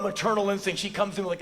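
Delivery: ordinary talk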